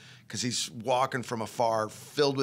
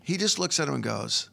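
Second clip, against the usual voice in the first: ordinary talk, nothing marked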